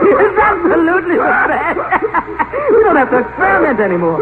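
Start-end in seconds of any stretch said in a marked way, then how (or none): 1.8-2.6 breathy chuckle. Acted. Laughing at the best joke.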